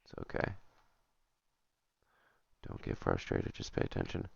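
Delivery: hushed coaching of yourself; whispered self-talk. Real, not acted. Player calming himself mid-game, whispered self-talk. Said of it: "talking himself down quietly".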